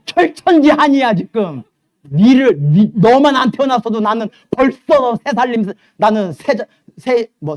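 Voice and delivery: loud, high ranting mother; furious mother. Acted. Mother shouting back at her teen about her marriage, loud bitter rant. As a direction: let the bitter mom explode at her kid.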